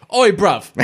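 laughing cockney Oi bruv; mock London slang accent; British accent. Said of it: "all I was hearing when she talked".

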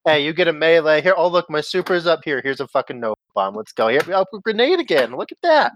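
rising, gleeful running commentary; excited play-by-play. Pretend. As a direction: narrate the fight like it's happening. Acts out his own gameplay.

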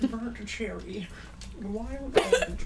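slow drawn out. Acted. Slow savoring flavor names.

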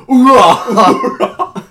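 loud, high gung-ho "Hoorah!"; loud gung-ho soldier. Retold or imitated. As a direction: bark it like a proud soldier. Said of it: "and we just hear".

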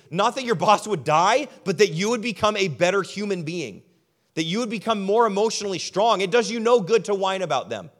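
fervent preacher urging the congregation; preaching at full force. Acted. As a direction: drive the point home from the pulpit.

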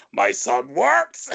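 high, drawn-out mock shout; hammed-up cry, stretching the words; retold shout. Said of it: "and he shouts".

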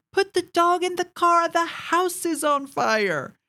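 high urgent yell, slowing on the last words; retold yell. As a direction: yell at her to get the dog out. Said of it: "I yell at her".

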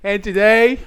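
high, loud put-on Boston proclamation; mock Boston accent. Banned fan making his pledge, loud mock Boston accent. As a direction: declare it in hammy Boston. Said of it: "Boston accent".

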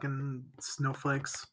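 parodying an angry conservative, quietly; mock grumbling conservative. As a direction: grumble like a cranky pundit. Mock Republican persona.

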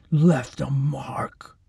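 very slow breathy whisper; whispered menace. Acted. Orderly finishing his threat to the girl in a whisper. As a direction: let the last words fade into a whisper.

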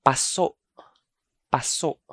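paso as pa-SO', final vowel cut off; short, stopped paso. Shown as a demonstration. Teacher gives the counter pronunciation, paso short and stopped. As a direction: keep "paso" short, stop the so abruptly. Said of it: "Its counter pronunciation is paso".

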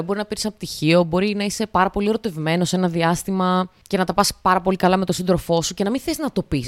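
usual speaking voice

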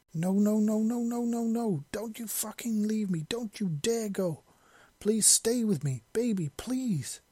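high, breaking cries of no, slowing as he begs; anguished pleading. A narrator's reading. Soldier begs his dying lover to stay, high and broken. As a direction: beg her not to die, voice high and cracking.

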